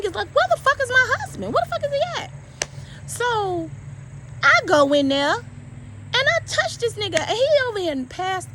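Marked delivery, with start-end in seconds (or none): 0.3-2.3 high, strained, panicked questioning; reliving the fright, voice shooting up; frantic panic. Retold or imitated. Wife woken by an intruder, panicking about her husband.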